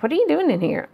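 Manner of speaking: a husband catching her lying down, startled; husband's question. Retold or imitated. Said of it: "He's like".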